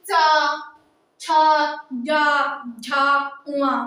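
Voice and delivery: "चा, छा, जा, झा, उआ" one at a time, long open vowels; syllable demonstration. Shown as a demonstration. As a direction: sound out "चा, छा, जा, झा, उआ" separately for students to repeat. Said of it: "हमारे साथ बोल के देखिए". ("चा, छा, जा, झा, उआ" अलग-अलग, स्वर खींचकर उच्चारण)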